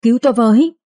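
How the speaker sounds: high, frantic plea for help; terrified cry for help. A narrator's reading. Madwoman begging the maid for rescue, terrified. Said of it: "kêu khóc rất thảm thương".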